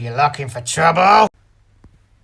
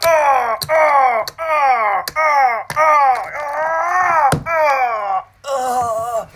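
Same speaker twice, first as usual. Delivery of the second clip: pained cries; 10 semitones higher, 4 dB louder, much slower than usual. Acted. Being tortured, crying out.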